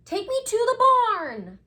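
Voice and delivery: high, loud little owl demand; a small bird ordering its ride. Acted.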